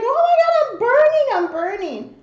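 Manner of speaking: high, loud cry of burning pain, easing at the end; acted high-pitched agony; re-enacted panicked screaming. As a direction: scream for help as if scalded. Re-enacts screaming while scalded.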